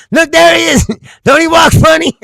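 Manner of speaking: high, loud giggly imitation of a friend; mock excited friend. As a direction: shout it out like she's pointing and laughing. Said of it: "She's like".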